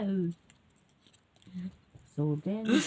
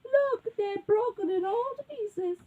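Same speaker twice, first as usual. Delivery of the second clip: high, slow mock sob; crying little bear; 12 semitones higher, slower than usual. A narrator's reading. Little bear with broken chair, crying dramatically. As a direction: wail it high and drawn out. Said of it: "And the little bear says".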